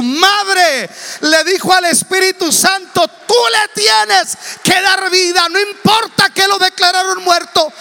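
high, loud revival shouting; impassioned preacher at full volume; full-force preaching. Acted. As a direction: cry it out to rouse a congregation.

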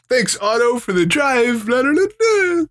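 sing-song high polite voice; high cheery thanks. Retold or imitated. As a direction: chirp it up high and sweet. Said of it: "a little, like".

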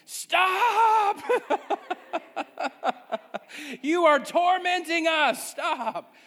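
high, loud, strained cry of the aching muscles; screaming muscles. Retold or imitated. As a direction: squeal like tortured thigh muscles. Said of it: "is screaming at me, saying".

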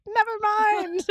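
high drawn-out never mind; high, slow sing-song quote. Retold or imitated. Quoting her own reaction.

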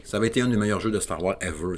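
ordinary talk

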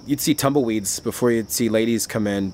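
ordinary talk

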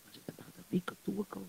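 fast whispered aside; gossipy whisper. Acted. Shamas whispering gossip to himself about the widow, fast. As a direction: lean in and whisper the gossip quickly.

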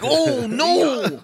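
high, hammed-up "oh no"; mock dismay. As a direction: fake alarm in a high voice. Mock reaction to elbow bending.

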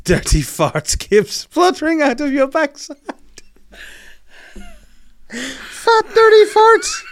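high, breaking voice, laughter taking over; reading through laughter. Real, not acted. Reading Joyce's letter aloud, he collapses into laughter. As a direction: lose it while reading.

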